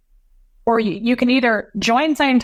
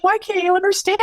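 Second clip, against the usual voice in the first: imitating a desperate sobbing plea; 11 semitones higher than usual. Son defending mom to grandfather, high tearful outburst. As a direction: cry it out, pitched way up. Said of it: "it was so heart-wrenching".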